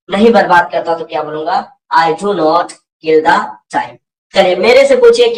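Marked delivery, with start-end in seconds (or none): 2.0-4.0 very slow, every word separated; patient teacher drilling the negative; slow model answer. Shown as a demonstration. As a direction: say it slowly for learners to copy.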